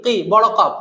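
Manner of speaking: ordinary talk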